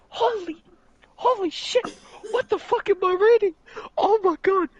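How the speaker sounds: very high, squealed astonishment; stunned disbelief. Retold or imitated. Reader retelling his horror at the comic, stunned. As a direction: replay the shock in a high, incredulous pitch. Replaying own shocked reading reaction.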